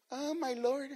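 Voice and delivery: mock-timid, soft and unsure; timid mumble. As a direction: whisper it shyly, the wrong way. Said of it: "No, no. Say it boldly".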